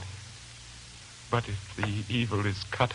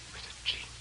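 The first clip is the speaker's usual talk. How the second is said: hushed whisper; 9 dB quieter, much faster than usual. Acted. Lodger speaks of dead brother.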